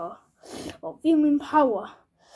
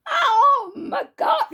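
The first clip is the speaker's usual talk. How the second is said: slow, much higher shout of shock; shocked exclamation; 12 semitones higher, 3 dB louder, slower than usual. Acted. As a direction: gasp it out in total shock. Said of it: "he's like".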